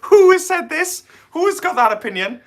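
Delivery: high, loud disbelieving question; incredulous. Real, not acted. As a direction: play up mock outrage. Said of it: "Adam's actually a very good dancer".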